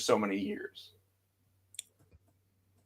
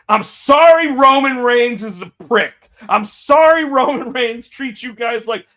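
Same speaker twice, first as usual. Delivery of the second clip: louder, higher mocking apology; raised voice; 8 semitones higher, 8 dB louder than usual. Imitating Punk raising his voice to insult Roman. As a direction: raise it, sneering sorry. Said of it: "As soon as he raised his voice to say".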